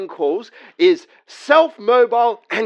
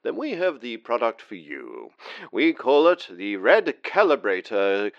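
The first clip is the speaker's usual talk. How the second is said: ad read; 9 semitones lower, 5 dB quieter than usual. Acted. Pitchman selling the device to viewers, ad read. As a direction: sell the product like a late-night ad.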